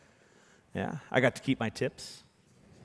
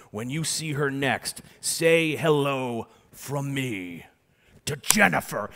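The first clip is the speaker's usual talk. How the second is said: drawn-out showman's reveal; milk the reveal, slow and grand; slow theatrical magician reveal; slow dramatic reveal; much slower than usual. Retold or imitated. Dramatic reveal line to guest.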